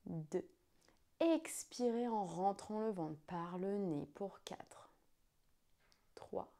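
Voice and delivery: slow, hushed guided breathing count. Acted.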